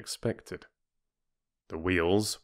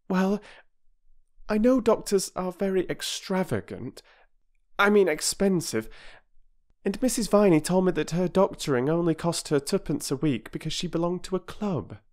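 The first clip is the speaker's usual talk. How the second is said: put-on high, eager young girl's voice; a girl's higher, earnest voice; girl Bobby; 11 semitones higher than usual. A narrator's reading. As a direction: plead earnestly as a little girl.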